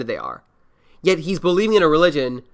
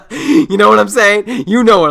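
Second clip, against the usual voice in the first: loud laugh, then a higher, louder question; 7 semitones higher, 9 dB louder than usual. Real, not acted. Laughing with the co-host about drug dealers.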